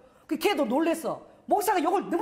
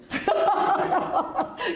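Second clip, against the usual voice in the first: hearty laugh; 7 semitones higher than usual. Real, not acted. Pastor to congregation, laughing at the girl's reply. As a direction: let the laugh burst out. Granddaughter's reply amuses him.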